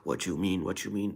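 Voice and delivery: usual speaking voice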